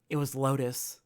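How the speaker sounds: usual speaking voice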